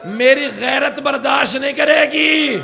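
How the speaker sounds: sermon climax shouted in sorrow; anguished shouted lament. Acted. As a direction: cry it out to the whole gathering in grief. Climax of recited prayer.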